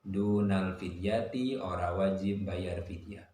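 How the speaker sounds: normal talking voice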